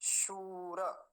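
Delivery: शूर drawn out long; slow recitation; slow demo. Shown as a demonstration. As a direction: stretch शूर slowly. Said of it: "वो है शूर".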